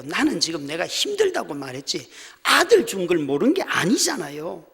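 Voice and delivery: normal talking voice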